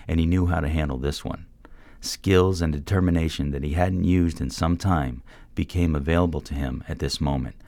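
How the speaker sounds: ordinary delivery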